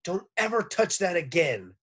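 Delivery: stern order. A narrator's reading. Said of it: "Missus Drizzle ordered".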